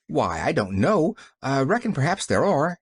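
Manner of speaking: ordinary delivery, nothing marked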